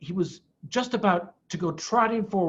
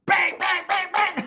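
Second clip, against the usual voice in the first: each bang climbing much higher; gleeful bangs; 13 semitones higher, 4 dB louder than usual. Acted. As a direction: relish every shot. Bean describes shooting the fox.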